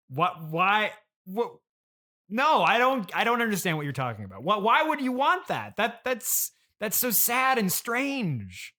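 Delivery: normal talking voice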